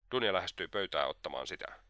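ordinary delivery

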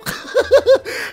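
high loud burst of laughter; high laughing outburst; big laugh. Real, not acted. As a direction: laugh out at the punchline. Reacts to chapeau punchline.

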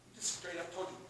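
usual speaking voice

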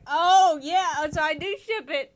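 loud, high fangirl shout; real gleeful yell; excited outburst. Real, not acted. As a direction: burst out with delight, very loud. Delighted outburst about a pairing.

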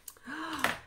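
sharp high intake of breath; dramatic gasp. Pretend. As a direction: gasp at the cliffhanger. Mock suspense after reading the cliffhanger.

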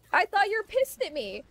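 high, loud imitation of an upset mom; mock protest. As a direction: retell it as an indignant protest.

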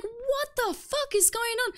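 slow, high bewildered mock; drawn-out disbelief; incredulous. Herself baffled by the raging players, slow disbelief. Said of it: "I'm like".

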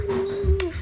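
usual speaking voice

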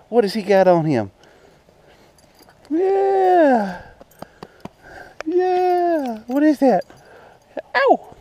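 high-pitched doggy talk. Real, not acted. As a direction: squeal at the pups like they're babies. Squealing baby talk to dogs.

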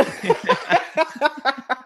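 shrieking high laugh; giddy squeal of laughter; high-pitched laugh. Real, not acted. Big laugh after joke.

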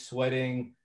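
normal talking voice

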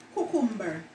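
normal talking voice

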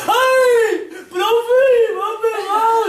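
high, loud hammed-up crying wail; mock-sob to the teacher; wailing child. A hit child wailing to the teacher, hammed-up crying.

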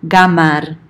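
"gamar" starting with a hard g; "gamar" said GA-mar with hard g. Shown as a demonstration. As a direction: begin "gamar" on g as in "go". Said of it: "can be pronounced".

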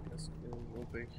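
hushed unvoiced question; whispering. Real, not acted. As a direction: whisper it carefully. Sneaking, whispering to self.

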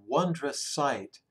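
ordinary delivery, nothing marked

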